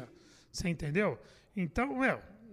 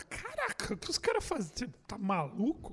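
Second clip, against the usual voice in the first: astonished reader reaction; 5 dB quieter than usual. Retold or imitated. Comedian voicing a reader of old Mad magazines, astonished. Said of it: "você fala".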